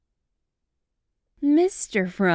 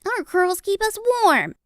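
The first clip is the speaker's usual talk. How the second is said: high, loud little sheep; high storybook sheep voice; 13 semitones higher, 4 dB louder than usual. A narrator's reading. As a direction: pipe up as a sheep. Sheep goes on speaking.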